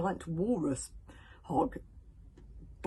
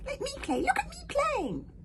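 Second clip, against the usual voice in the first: squeaky creature voice; 4 dB louder than usual. Acted. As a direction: squeak it proudly. Hogfly squeaky voice.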